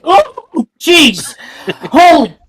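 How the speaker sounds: high, loud panicked yelps at a snake; terrified shriek. Acted. Student spotting a snake in class, panicked shrieks.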